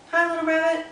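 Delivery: high, sing-song hello to a puppet; kid greeting. Shown as a demonstration. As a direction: greet the bunny as the children would.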